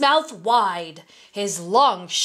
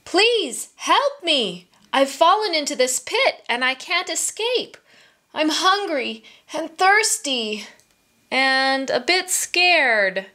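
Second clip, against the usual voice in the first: mildly scared, imploring tiger in a higher voice; pleading; 7 semitones higher than usual. Acted. Trapped tiger asks a merchant for help, pleading.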